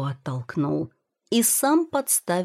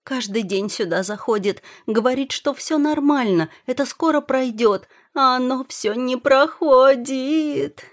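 tearful wailing; 7 semitones higher, 4 dB louder than usual. A narrator's reading. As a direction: whine it higher and higher, stretching the end. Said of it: "всхлипнула Юэ". (плаксиво, срываясь в причитание)

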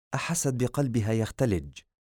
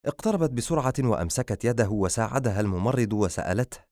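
usual speaking voice

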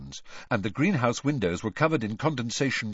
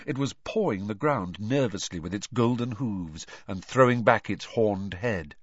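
usual speaking voice

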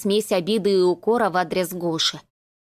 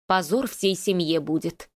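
usual speaking voice

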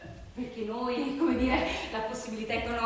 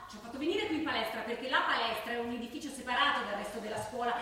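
ordinary talk, nothing marked

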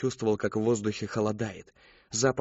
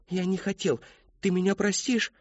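lightly voiced sorry boy; apologetic boy; 8 semitones higher than usual. A narrator's reading. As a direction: ask forgiveness meekly.

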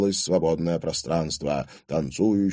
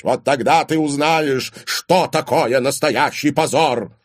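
loud, raised-pitch furious warning; angry threat; 7 semitones higher, 6 dB louder than usual. A narrator's reading. Father warns daughter about disgrace, furiously. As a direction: drive the threat home.

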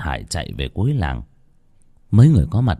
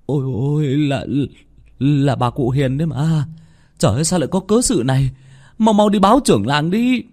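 high-pitched panic, building to a shout; alarmed cry rising in urgency; horrified villager; 6 semitones higher than usual. A narrator's reading. Villager finds a mangled body.

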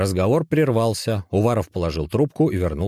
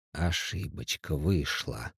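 hushed slow whisper, much softer; 2 semitones lower, 7 dB quieter, slower than usual. A narrator's reading. Напарник тихо шепчет товарищу вплотную, hushed whisper. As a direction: lean in close and breathe it out quietly. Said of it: "прошептал Уваров".

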